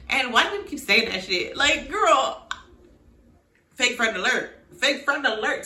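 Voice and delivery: loud, high calling out a fake friend; indignant call-out. Real, not acted. Vlogger yelling at Taylor over bad advice, indignant shout. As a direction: shout the warning at the screen. Ranting at character.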